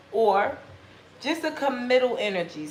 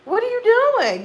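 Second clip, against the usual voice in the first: high, loud, slow what-the-fuck; stunned and outraged at the window; high incredulous shout; shocked disbelief; 9 semitones higher, 6 dB louder than usual. Pretend. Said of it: "And you like".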